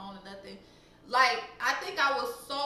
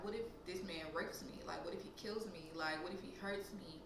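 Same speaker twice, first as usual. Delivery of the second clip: inner worry; 5 semitones lower, 10 dB quieter than usual. Retold or imitated. Voicing her fearful thoughts.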